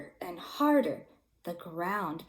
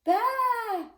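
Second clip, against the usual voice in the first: shouted bah; 12 semitones higher than usual. A narrator's reading. Goat alone underground shouting bah. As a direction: bleat it out into the dark. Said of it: "shouted Marvin".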